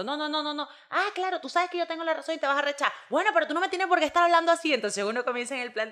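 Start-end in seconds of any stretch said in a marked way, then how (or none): 0.0-0.7 mock denial. Acted quarrel, husband denies. 0.9-4.7 high, loud mock argument; hammed-up row with raised voice; mock heated quarrel. Wife and husband argue: high, loud mock quarrel. Acted quarrel between spouses.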